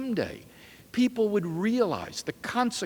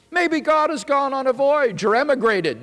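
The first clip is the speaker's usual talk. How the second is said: mocking taunt; 9 semitones higher, 7 dB louder than usual. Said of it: "they laughed".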